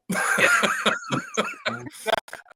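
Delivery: bursts into a high, open laugh; real laugh at the memory; high delighted laugh. Real, not acted. Laughing at his own quote.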